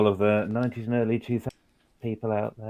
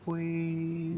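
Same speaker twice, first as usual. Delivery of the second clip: higher, soft, sugary little Please; cute and sweet; 5 semitones higher, 8 dB quieter than usual. Acted. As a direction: melt her with the sweetest Please you have. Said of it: "look as cute and sweet as you can".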